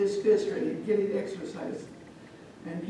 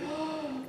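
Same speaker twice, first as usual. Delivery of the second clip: soft, high intake of breath; shaken gasp; 8 semitones higher, 6 dB quieter than usual. Acted. Boy sitting by daddy, shaken gasp. Boy sits, still frightened.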